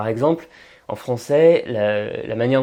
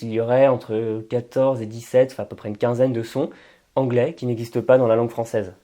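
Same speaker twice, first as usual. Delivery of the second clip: ordinary delivery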